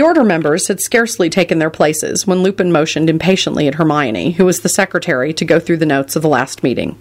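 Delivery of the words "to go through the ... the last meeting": plain reading voice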